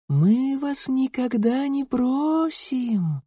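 high, slow eerie whisper-voice; echoing ethereal being; otherworldly rustle. A narrator's reading. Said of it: "прошелестел тихий потусторонний голос". (потусторонний шелестящий голос)

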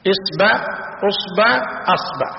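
"إصبع، أصبع، أصبع" with i, then u, then a on the hamza; إصبع بالكسر ثم الضم ثم الفتح; three hamza vowels. Shown as a demonstration. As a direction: list "إصبع" "أصبع" "أصبع" vowel by vowel: isba, usba, asba. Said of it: "مثلثة الهمز".

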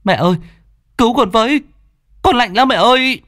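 high, loud panicked cry for mother; a feverish boy shrieking for his mother; startled cry. Acted. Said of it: "kêu lên thảng thốt".

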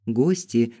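ordinary delivery, nothing marked

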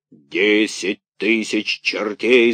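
drawn-out booming villain yell; slow roaring curse. A narrator's reading. Karabas curses at Buratino, slow roar. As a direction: roar it like an angry puppet-master. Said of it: "заорал Карабас-Барабас".

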